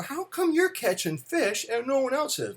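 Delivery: high-pitched imitation of a kid; curious child. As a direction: play the boy questioning his dad, pitched right up. Retelling child's question to father.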